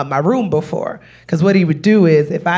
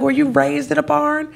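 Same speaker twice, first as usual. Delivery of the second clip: incredulous; 7 semitones higher than usual. Retold or imitated. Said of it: "It's like".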